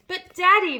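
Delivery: loud plea. A narrator's reading. Matilda pleading.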